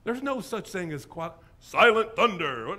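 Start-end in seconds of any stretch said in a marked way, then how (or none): 1.6-2.7 loud, slow, drawn-out billing; wrestler name. Acted. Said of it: "sounds like a bad pro wrestler name".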